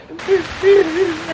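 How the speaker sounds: high, whimpering mock sobs; imitated sobbing. Crying tourist unable to answer the man, mock sobbing. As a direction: mimic helpless crying in short bursts. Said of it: "I was crying so hard".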